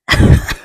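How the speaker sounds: high giggle. Real, not acted. Laughing at own story.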